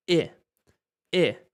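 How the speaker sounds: E said as a pure Spanish e vowel; Spanish E sound. Shown as a demonstration. Teacher isolating the e vowel for learners. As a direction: hold a clean e. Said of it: "que en vez de I es E".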